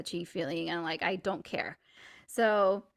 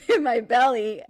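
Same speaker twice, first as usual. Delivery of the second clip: usual speaking voice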